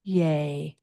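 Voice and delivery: "yay" rhymes with day, a clear AY. Shown as a demonstration. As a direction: model the final syllable. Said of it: "the final E is pronounced as the syllable A Y".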